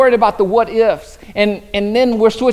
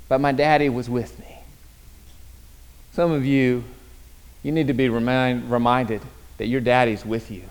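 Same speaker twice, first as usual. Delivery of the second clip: tender, lower; 7 semitones lower, 6 dB quieter than usual. Real, not acted. Tender point of the sermon.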